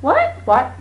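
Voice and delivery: a sudden baffled what what; surprised what. Acted. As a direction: parents caught off guard by the news. Family reacts in disbelief.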